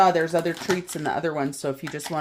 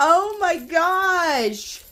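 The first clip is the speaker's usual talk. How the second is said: high, drawn-out oh my gosh; surprised; 11 semitones higher, 3 dB louder, much slower than usual. Real, not acted. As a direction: stretch it out in disbelief. Reacting to broken calendar.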